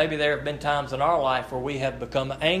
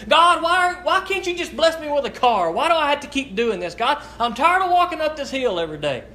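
higher, louder, fed-up cry to God; exasperated complaint; 10 semitones higher, 3 dB louder than usual. Retold or imitated. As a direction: vent like a worn-out man pushing his bike uphill. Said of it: "he was kind of angry and he was kind of short".

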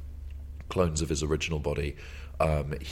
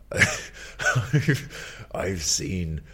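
dramatic monologue; slower than usual. Retold or imitated. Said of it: "the android says".

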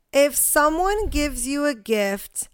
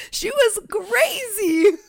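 slow, higher, through laughter; laughing; 9 semitones higher, much slower than usual. Real, not acted. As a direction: squeal it between laughs. Laughing about her cousin's past.

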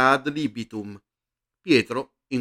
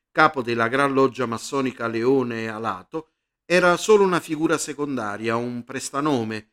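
usual speaking voice

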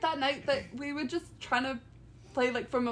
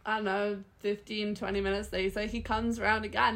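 usual speaking voice

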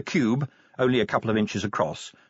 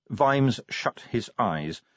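plain reading voice

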